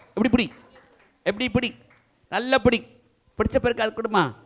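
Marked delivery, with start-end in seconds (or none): none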